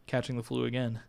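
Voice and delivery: normal talking voice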